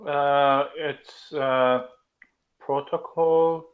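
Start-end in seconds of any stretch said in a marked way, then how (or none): none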